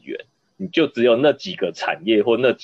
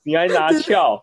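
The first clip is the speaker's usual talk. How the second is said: indignant; 7 semitones higher, slower than usual. Retold or imitated. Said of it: "echoing partner's word, indignant".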